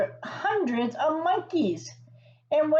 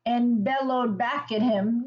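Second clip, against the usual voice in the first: usual speaking voice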